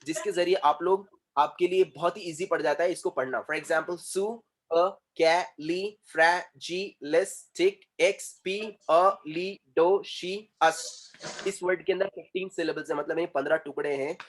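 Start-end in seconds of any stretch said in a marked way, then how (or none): 3.9-10.8 supercalifragilisticexpialidocious very slowly, split into separate syllables; spelled-out sound of supercalifragilisticexpialidocious, one syllable at a time. Shown as a demonstration. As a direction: break supercalifragilisticexpialidocious into beats with a gap after each. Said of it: "हिज्जे करके पढ़ना".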